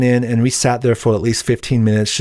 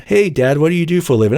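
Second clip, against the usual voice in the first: higher-pitched pretend kid asking Dad; mock child's question; 6 semitones higher than usual. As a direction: lightly play a curious child. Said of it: "Can you imagine that?".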